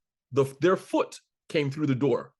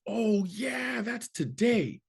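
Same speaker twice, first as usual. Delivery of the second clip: high, slow, clueless "oh yeah"; oblivious bar guy; 9 semitones higher, much slower than usual. Retold or imitated. Bar guy realizing he forgot the comedy show. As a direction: play the guy who totally forgot.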